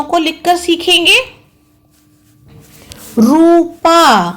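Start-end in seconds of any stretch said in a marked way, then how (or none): 3.2-4.3 रूपा said very slowly and drawn out; रूपा stretched like dictation. Shown as a demonstration. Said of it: "dictation word for pupils".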